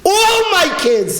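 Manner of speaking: high, drawn-out declaration; insistent parent. Acted. Parent refusing to give up any child, insistent high cry. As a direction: protest slowly, high in pitch, as a parent. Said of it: "I never heard a parent talk".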